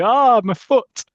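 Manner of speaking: high, loud, drawn-out comic howl; howl of pain. Acted. Boss with a present dropped on his foot, howling. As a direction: wail in mock agony.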